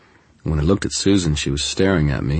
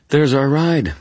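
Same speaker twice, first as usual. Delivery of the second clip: defiant; 11 semitones higher, 6 dB louder than usual. A narrator's reading. Surge of defiant energy.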